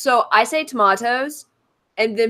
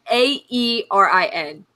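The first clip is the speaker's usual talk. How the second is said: slow, separate letters; spelling it out. Real, not acted. Telling viewers how to spell the name, slowly spelt out. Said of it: "people are having issues spelling my name".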